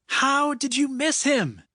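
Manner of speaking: high-pitched angry yell from behind. A narrator's reading. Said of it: "he heard Abigail yell".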